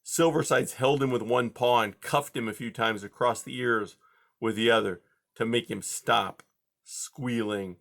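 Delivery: usual speaking voice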